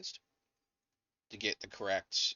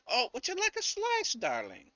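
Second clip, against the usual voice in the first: much higher, sweet granny voice; kind old lady. Acted. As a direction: offer pie like a sweet grandma. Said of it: "the kind old lady".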